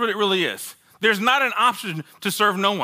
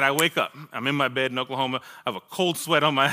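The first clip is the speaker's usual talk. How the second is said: ordinary talk, nothing marked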